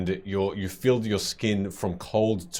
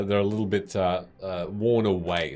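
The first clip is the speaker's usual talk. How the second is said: usual speaking voice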